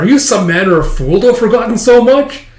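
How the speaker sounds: high, loud, affronted rival; indignant scolding. Acted. Top scorer berates forgetful Anya, indignant. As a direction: demand it in mock indignation.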